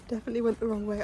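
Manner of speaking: normal talking voice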